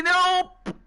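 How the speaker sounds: sudden high-pitched cry of rejection; stretched, high yelp of dismay; high exclaimed refusal. Real, not acted. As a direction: burst out with it in protest. Streamer rejecting what he dislikes.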